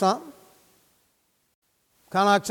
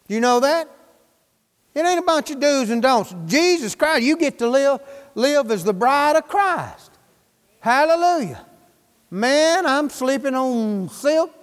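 usual speaking voice

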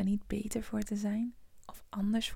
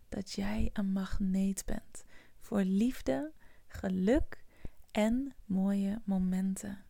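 usual speaking voice

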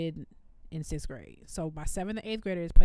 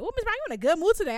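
sassy student; 10 semitones higher, 4 dB louder, much faster than usual. Retold or imitated. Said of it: "this one girl was like".

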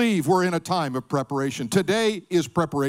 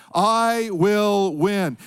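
drawn-out declaration; much slower than usual. Shown as a demonstration. Preacher leading the congregation, slow drawn-out vow. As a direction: stretch I will win out very slowly. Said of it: "can you say it with me?".

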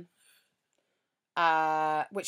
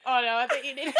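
laughing imitation; 5 semitones higher than usual. Mimicking friend's face.

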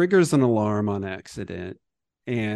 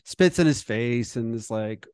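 ordinary delivery

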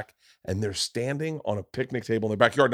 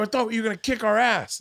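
put-on taunting kid; 10 semitones higher than usual. Kids taunting him.

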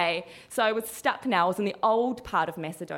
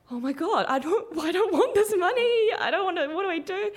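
laughing panic; 10 semitones higher than usual. Retold or imitated. Tourist handed cash by scammers, giggly panicked protest. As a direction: sputter in mock alarm with a laugh. Panicking over unwanted money.

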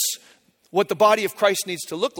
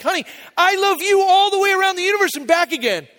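very high, sustained sing-out of fatherly love; hammed-up doting daddy voice; gushing dad; 10 semitones higher, 3 dB louder than usual. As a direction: top the child with an over-the-top high gush. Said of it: "I was like".

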